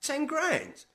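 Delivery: high, loud burst of disbelief; astonished at the offer; incredulous echo. Acted. Taken aback by cash offer.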